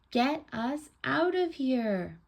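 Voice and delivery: slow, high, loud storybook yell; drawn-out acted cry for rescue; yell for help. A narrator's reading. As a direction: holler up from the hole, drawing it out. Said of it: "mouth and yelled".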